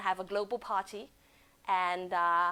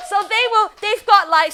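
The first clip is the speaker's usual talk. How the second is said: higher and louder, still buzzing; excited; 9 semitones higher, 14 dB louder than usual. Real, not acted. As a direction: carry the cheer into the sentence. Still riding the cheer.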